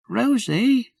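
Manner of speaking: put-on high, puzzled little-boy voice; a small child wondering aloud; small boy Jim. Little Jim asks his sister why it's dark, high boy's voice. Jim's voice.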